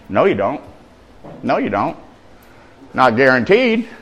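ordinary talk

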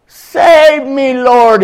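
high, loud desperate cry for help; shouted plea. A narrator's reading. Peter sinking calls to Jesus, a high loud shout. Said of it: "he shouted".

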